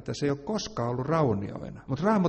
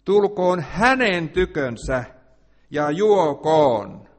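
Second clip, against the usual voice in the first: slow, louder proclamation; preaching invitation; 3 semitones higher, 7 dB louder, much slower than usual. Acted. Preacher inviting the thirsty to come, loud and slow. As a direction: call them forward, drawn out.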